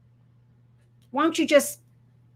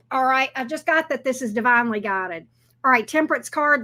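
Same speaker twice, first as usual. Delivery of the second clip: normal talking voice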